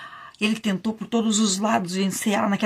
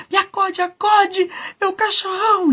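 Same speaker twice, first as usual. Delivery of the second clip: much higher, loud plea for help; frantic call for rescue; desperate scream; 12 semitones higher, 4 dB louder than usual. A narrator's reading. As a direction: scream to the dog as you lose. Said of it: "Pedro então gritou".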